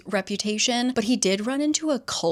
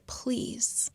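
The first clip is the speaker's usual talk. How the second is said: softer whispered plea; desperate plea; 3 semitones higher, 9 dB quieter than usual. Retold or imitated. Julian begging Emma to heal him, whispered and desperate. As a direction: beg it under your breath. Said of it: "The desperation clear in his voice".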